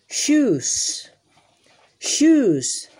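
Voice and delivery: shoes said high and loud with a long sh; emphatic model word. Shown as a demonstration. Teacher models shoes for children, loud and high. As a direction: call out shoes for the kids to copy. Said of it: "se dice shoes".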